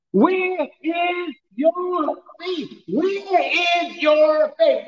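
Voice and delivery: drawn out, slow, each word leaned on; slow emphatic preaching. Acted. Preacher pressing his flock on their faith, slowly. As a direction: stretch it out and let it sink in.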